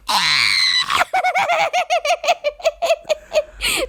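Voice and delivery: long, very high squeal of glee; loud excited squeal. Real, not acted. New wife reacting to being introduced as wife, ecstatic squeal. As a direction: squeal loudly into laughter. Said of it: "going to get loud as soon as I do this intro".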